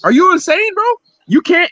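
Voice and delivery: usual speaking voice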